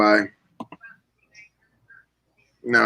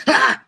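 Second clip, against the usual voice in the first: sudden high yelp of pain, imitated; mock yell; 12 semitones higher than usual. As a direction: yelp high like you just got bitten. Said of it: "he goes".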